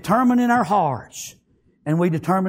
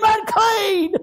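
very high, long-held cry; screamed warning; 13 semitones higher, 3 dB louder than usual. Acted. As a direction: cry it out like a desperate leper. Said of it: "they scream".

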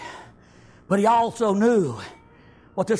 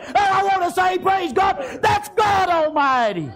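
higher, loud preaching cry, slowing on the last words; full-force preacher's exclamation; shouted praise; 9 semitones higher, 3 dB louder than usual. Acted. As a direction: shout it out to the congregation.

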